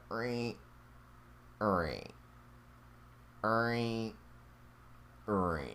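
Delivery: soft rhythmic creak imitation; copying the creak sound he heard; imitating creaks. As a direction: mimic a floorboard creaking, quietly. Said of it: "It was like a rhythmic creak".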